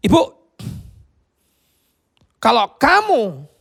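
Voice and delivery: preacher confronting the pastor, slow and loud; forceful confronting. Retold or imitated. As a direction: call her out slowly.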